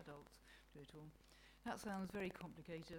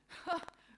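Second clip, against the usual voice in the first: short, high, loud scoff; scornful "ha"; 11 semitones higher, 9 dB louder than usual. Acted. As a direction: let out a derisive huff of laughter. Mother Nature scoffs at God.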